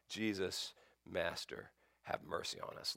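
soft, pleading, fading to a breath; hushed plea. Retold or imitated. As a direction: beg for mercy under your breath. Lepers calling to Jesus.

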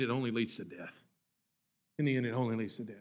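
low, soft grave warning; preacher warning congregation, hushed and grave; solemn. Real, not acted. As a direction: let it drop heavy and quiet.

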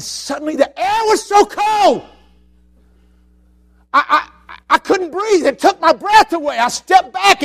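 loud, high, rising preacher voice; preaching at full force. Acted. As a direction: pour it out like a fiery storyteller. Preaching a vivid story.

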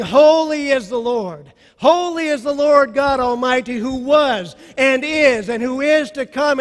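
loud praise. Retold or imitated. Pastor voicing all creation singing holy, full and loud. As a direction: pour it out like a crowd praising.

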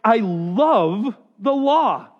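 slow declaration. Shown as a demonstration. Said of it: "my reaction has been to say".

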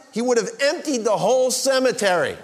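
high, loud preaching climax; full-force preaching. Acted. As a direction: preach it with rising power.

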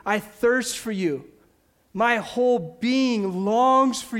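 slow, earnest psalm read; slow devotional reading; scripture reading. Acted. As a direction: read the psalm slowly like a prayer. Reading Psalm sixty-three.

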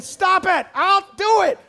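put-on loud, high toddler yell; sharp, high mock tantrum shouts; protesting toddler. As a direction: imitate a toddler refusing food. Toddler son refusing to be fed.

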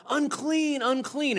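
high warning call; crying out a warning. Acted. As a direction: call it out so people keep away. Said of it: "they had to cry out".

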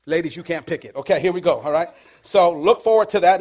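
ordinary delivery

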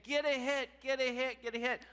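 high, loud mock cheering chant; cheering relatives. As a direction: chant it like relatives urging on a batter. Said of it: "they're saying".

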